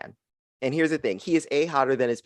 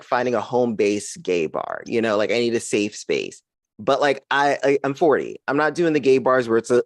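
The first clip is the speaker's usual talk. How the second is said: usual speaking voice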